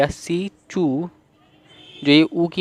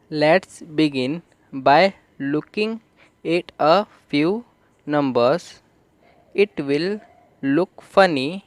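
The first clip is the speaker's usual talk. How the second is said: word by word, very slowly; slow classroom read-aloud; slow reading demo; much slower than usual. Shown as a demonstration. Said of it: "तो funny को हम थोड़ा सा देख लेते हैं".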